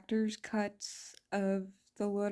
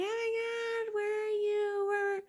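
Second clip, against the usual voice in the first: high-pitched, mock-frantic calling out; mock panicked survivor; 12 semitones higher than usual. Mocking survivors strolling after the eruption, high mock panic. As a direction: squeak it like a dazed survivor hunting for someone. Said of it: "People just walking around like".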